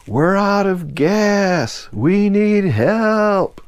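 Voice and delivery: high, very slow drawn-out shout; yelling for help. Retold or imitated. Dan shouts to the freighter crew again, slow high yell. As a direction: drag each word out to reach the deck. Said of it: "Then Dan yelled".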